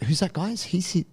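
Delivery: put-on confused girlfriend. Girlfriend lost in the Star Wars plot, mildly high confused asking. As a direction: lightly play a lost new viewer. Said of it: "She's like".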